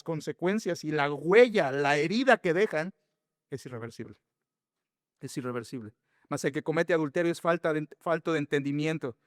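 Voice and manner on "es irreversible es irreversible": hushed. Real, not acted. Preacher drops voice on key point.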